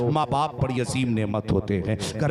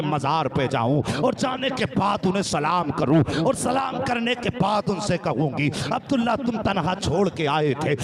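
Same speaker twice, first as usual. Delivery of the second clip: soaring, fervent preacher's cadence, pitched higher; impassioned preaching; 4 semitones higher than usual. Acted. Preacher carrying the gathering through a mother's grief, fervent. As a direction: pour the story out at full force to the crowd.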